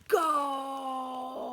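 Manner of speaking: high, loud goal cry; triumphant shout. Acted. Bulk celebrates his goal with a loud cry. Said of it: "shouted Bulk triumphantly".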